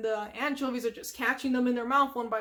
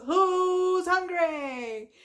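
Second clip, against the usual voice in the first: high, very drawn-out calling shout; SpongeBob; 6 semitones higher, 5 dB louder, much slower than usual. Retold or imitated. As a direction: holler it out to a hungry crowd. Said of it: "And he announces".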